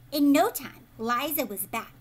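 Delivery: ordinary delivery, nothing marked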